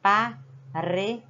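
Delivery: Paredé, slow, with a flapped r; tapped R. Shown as a demonstration. Teacher sounding out a word with the weak R. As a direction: say Paredé slowly, tapping the r. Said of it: "Olha o som tremido".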